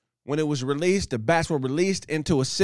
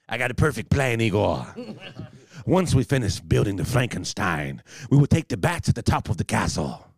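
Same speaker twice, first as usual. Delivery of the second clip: low, hammed-up evil mastermind; mock-sinister plotting voice, pitched down; cartoon villain; 3 semitones lower than usual. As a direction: scheme grandly like a cartoon mad scientist, drawing out key words. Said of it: "villainous cartoon animal came up with this plot".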